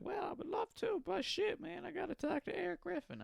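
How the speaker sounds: very high-pitched falsetto mock voice; badly faked impersonation in a squeaky falsetto; Bobby Lee impression. Podcaster imitating a comic answering his friend's idea, high mock voice. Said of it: "I know he doesn't sound like that, but I'm not good at impersonations".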